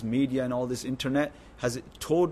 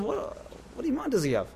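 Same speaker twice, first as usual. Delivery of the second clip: ordinary talk, nothing marked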